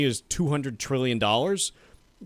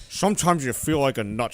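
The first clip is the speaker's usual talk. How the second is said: Sean Connery impression. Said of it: "Sean Connery reads economics headlines".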